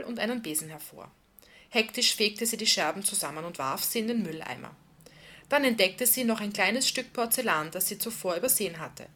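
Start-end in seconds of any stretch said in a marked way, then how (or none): none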